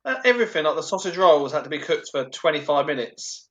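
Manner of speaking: ordinary talk, nothing marked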